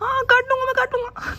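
high falsetto wife scolding; mimicking wife. Husband mimics his wife accusing him, high falsetto. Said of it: "mimicry कर रहा हूँ मैं".

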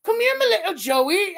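put-on high, loud, botched Australian accent; bad Australian accent. Kangaroo mother calling her joey, badly faked Australian accent. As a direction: attempt a hammed-up Australian call. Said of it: "I can't do an Australian accent".

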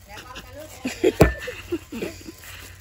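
airy laugh with no voice; breathy laugh. Real, not acted. Laughs while smashing mango. (tiếng cười thở ra)

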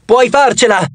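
high-pitched, restrained shout; encouraging cry. A narrator's reading. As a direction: cheer him on from a little way off. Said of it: "gridai a Tyson". (grido di incoraggiamento, contenuto)

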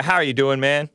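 ordinary talk, nothing marked

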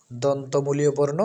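ordinary talk, nothing marked

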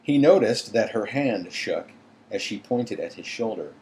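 usual speaking voice

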